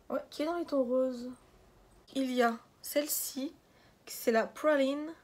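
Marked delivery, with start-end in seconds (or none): 4.3-5.1 PRALINE drawn out slowly in an English accent; put-on English accent. Real, not acted. Vlogger naming a French-named palette by mistake in English accent. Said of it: "Pourquoi je prends un accent".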